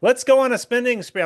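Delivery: prance it up in a silly high voice; mocking woman's voice. Mocking the accuser's TV answer in a high ditzy voice. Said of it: "And she goes".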